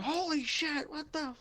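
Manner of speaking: very high-pitched mock scream; panicked screaming. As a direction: squeal in a high voice like terrified henchmen. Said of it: "start yelling and screaming".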